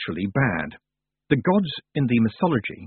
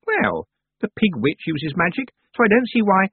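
defensive; 10 semitones higher, 4 dB louder than usual. A narrator's reading. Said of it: "began defensively".

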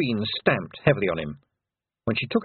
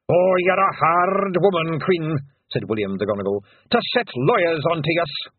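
William de Gonergal; 6 semitones higher, 3 dB louder than usual. A narrator's reading. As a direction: lament the Queen's cruelty. William addresses Queen.

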